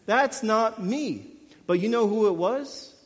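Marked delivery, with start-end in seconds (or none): 0.0-1.3 slow, emphatic denial; slowly and deliberately reject the idea; slow, drawn-out insistence that it isn't me; firm refusal. Retold or imitated. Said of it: "I'm like".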